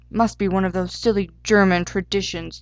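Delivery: ordinary delivery, nothing marked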